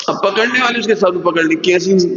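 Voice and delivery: ordinary talk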